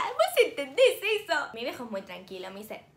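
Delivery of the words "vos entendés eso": slow, higher, thrilled; excited disbelief. Retold or imitated. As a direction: stretch it out in glee. Excited to dad. (emocionada e incrédula)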